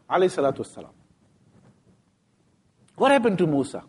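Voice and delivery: ordinary talk, nothing marked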